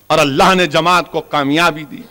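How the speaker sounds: ordinary talk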